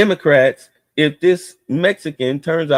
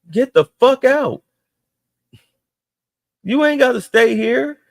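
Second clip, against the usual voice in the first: angry outburst; 9 semitones higher than usual. Real, not acted. Ranting at visa protesters, angry high outburst. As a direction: throw them out with your voice.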